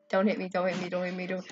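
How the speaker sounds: soft rapid pleading under breath. Real, not acted. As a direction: beg quietly, fading out. Dodging attacks.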